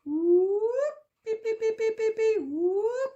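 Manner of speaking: high whooping and beeping like a car alarm; be the car alarm going off at night; imitating a bird copying an alarm siren; alarm imitation. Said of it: "I heard something go".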